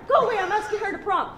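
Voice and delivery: fast, high, laughing shoo. Real, not acted. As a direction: laugh while brushing her off. Laughing, shooing intruder.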